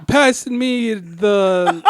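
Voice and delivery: drawn-out amateur British, about to crack up; attempted British accent. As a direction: struggle through a bad British accent. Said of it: "Let me hear your British".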